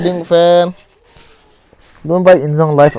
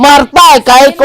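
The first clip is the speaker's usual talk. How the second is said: loud call; 11 semitones higher, 10 dB louder than usual. Real, not acted. Calling out mid-fight.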